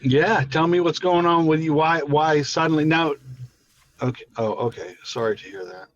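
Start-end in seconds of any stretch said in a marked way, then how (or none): 4.0-5.8 quiet sympathy. Real, not acted. Hearing of cousin's death.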